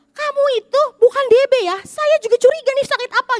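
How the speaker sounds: loud and high retold question; a loud uncle quizzing the patient in the hospital; loud, stereo. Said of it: "tapi agak stereo".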